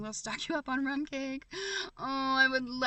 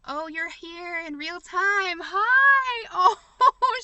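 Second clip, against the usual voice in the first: high delighted squeal, much louder; excited squeal; 9 semitones higher, 11 dB louder, much slower than usual. Real, not acted. Thrilled squeal greeting a viewer who joined live. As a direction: shriek hello with thrilled joy.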